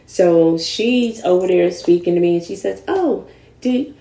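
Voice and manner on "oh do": high, sweet imitation of an eager church lady; sugary church lady. At the supermarket, mimicking the lady praising her, sweet and high. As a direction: lay on the flattery as the lady who wants you in church.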